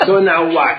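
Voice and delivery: bursting into laughter after the line; laughing. Real, not acted. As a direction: crack up at the memory. Recalls the gun scene laughing.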